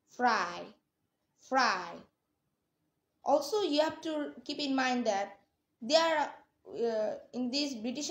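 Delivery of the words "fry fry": "Fry" with a clear r after the f; r sounded before vowel. Shown as a demonstration. Teacher giving a UK accent example, "Fry" with r sounded. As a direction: say "Fry" with the r pronounced. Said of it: "It is pronounced when it comes before a vowel".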